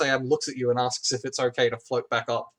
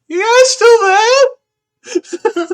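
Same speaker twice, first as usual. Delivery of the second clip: distant call; 13 dB louder, much slower than usual. Acted. GM voices distant Squeak calling.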